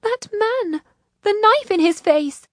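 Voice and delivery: high, horrified outcry; upset, high-voiced exclamation; distressed. A narrator's reading. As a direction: cry out in distress, pitched far up.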